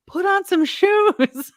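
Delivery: very high, loud, slow yell. Retold or imitated. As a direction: bellow it out slowly. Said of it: "the neighbor yells".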